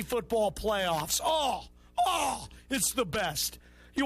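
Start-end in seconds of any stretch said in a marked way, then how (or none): 1.2-2.5 drawn-out "oh" of pure relish; blissful groans. Pretend. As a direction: groan with delight at the thought. Savouring a bye week.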